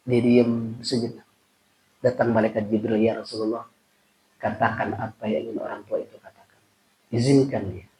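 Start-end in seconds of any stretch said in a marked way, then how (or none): 3.0-7.9 gentle angel voice. Retold or imitated. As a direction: deliver it hushed and reverent. Angel Jibril speaks softly.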